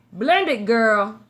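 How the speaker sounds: punchy Brooklyn call-out in a New York accent. Real, not acted. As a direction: say it with loud New York sass. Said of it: "My New York accent".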